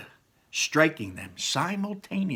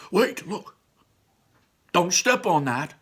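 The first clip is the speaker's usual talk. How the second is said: high urgent warning voice; Barley warning; 6 semitones higher than usual. Acted. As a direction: call out the warning high and quick. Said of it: "warned Barley".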